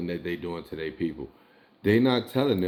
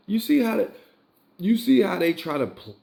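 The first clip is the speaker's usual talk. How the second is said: higher, louder exclaim in disbelief; outraged exclaim; 11 semitones higher, 3 dB louder than usual. Real, not acted. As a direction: burst out incredulous.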